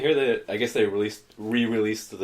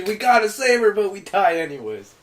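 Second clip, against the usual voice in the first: higher, louder, mock-earnest; mock-heroic and loud; mock heroic teen; 8 semitones higher, 5 dB louder than usual. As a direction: ham up a doomed rescuer. Mocking dumb movie teenagers.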